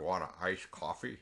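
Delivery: low, odd mock voice; weird-talking impression. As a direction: imitate a man who talks weird, pitched low. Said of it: "He talks weird".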